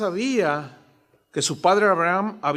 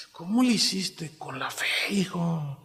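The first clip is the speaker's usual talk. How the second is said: hushed, breathy question to father; whispered plea; 5 dB quieter than usual. Retold or imitated. Son whispers to his father Abraham about faith, hushed. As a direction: lean in and whisper it.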